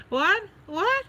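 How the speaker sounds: sharp high-pitched double what, caught off guard; startled what. Real, not acted. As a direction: blurt it out in disbelief. Reacting to a crazy comment.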